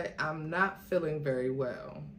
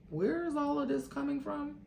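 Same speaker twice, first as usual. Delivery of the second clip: confused asking; 9 semitones higher than usual. Acted. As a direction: ask as if caught off guard. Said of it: "He looked confused as he asked".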